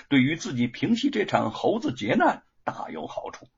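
ordinary delivery, nothing marked

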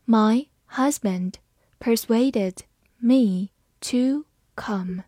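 slow, word by word; deliberate teaching pace; slow reading. Shown as a demonstration. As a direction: read it slowly for learners. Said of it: "好，慢慢来读。".

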